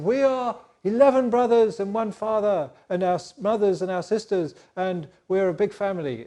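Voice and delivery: eager brother. Acted. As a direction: pipe up brightly about the family.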